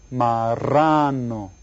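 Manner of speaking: slow, drawn-out "Marrano"; model for repeating. Shown as a demonstration. Teacher has everybody say it, slowly stretched. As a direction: stretch "Marrano" with a long middle syllable. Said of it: "Everybody say it".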